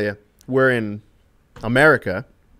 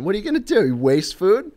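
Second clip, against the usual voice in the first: higher-pitched mock Australian accent; 7 semitones higher than usual. Friend objecting to wasted food, put-on Australian accent. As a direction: scold lightly as an imitated Australian. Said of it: "in his Australian accent".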